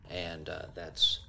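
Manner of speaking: usual speaking voice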